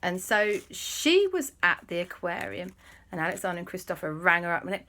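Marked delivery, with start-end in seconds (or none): none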